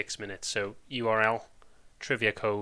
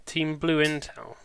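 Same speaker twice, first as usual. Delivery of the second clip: slow, higher, louder call-out; drawn-out announcement; 5 semitones higher, 5 dB louder, slower than usual. Real, not acted. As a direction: announce it slowly like a title. Calling out team names.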